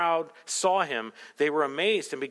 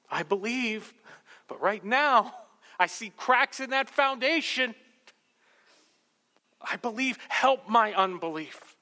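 pleading cry; 4 semitones higher, slower than usual. Acted. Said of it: "the boy's father cried out and said".

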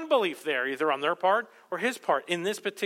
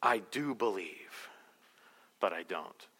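quiet confession; 11 semitones lower, 8 dB quieter, slower than usual. Acted. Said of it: "the boy's father cried out".

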